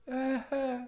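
breath catching before a sneeze; about to sneeze. Real, not acted. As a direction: hold back a coming sneeze. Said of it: "ich muss gleich niesen".

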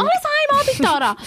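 echoing her friend, amazed; incredulous echo. Real, not acted. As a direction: throw it back in disbelief.

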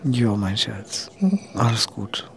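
slow, tender whisper to a pet; soothing whisper. Real, not acted. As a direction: whisper comfort to a sleepy animal.

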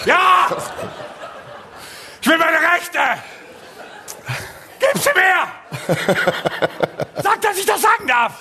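hammed-up demand. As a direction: yell like a demonstrator. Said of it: "Showmodus verfallen". (gespielte Forderung, laut)

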